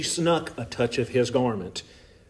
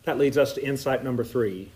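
usual speaking voice

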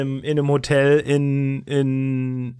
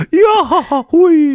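high, loud breathy squeal; hammed-up sultry moan; mock sex voice; 13 semitones higher, 9 dB louder than usual. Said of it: "aber Marlenes Fake-Sexstimme".

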